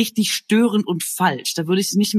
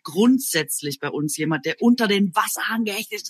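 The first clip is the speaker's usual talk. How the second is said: usual speaking voice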